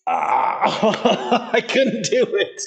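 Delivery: high laughing, slow. Real, not acted. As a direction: laugh through the words. Laughing at own failure.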